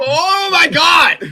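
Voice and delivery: slow drawn-out shout. Real, not acted. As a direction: stretch the yell out. Friends yelling at game crash.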